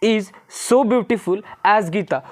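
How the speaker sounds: slow recap read. Shown as a demonstration. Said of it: "क्या सीखें".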